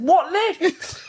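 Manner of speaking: very high, laughing what lift; half-laughing high-pitched confusion; retold disbelief. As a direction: squeak it out in baffled panic.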